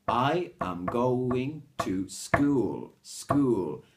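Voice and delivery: very slow, drawn-out sentence with SCHOOL as one syllable; no extra beat. Shown as a demonstration. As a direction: stretch each word, SCHOOL starting straight on S. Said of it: "It breaks up the rhythm".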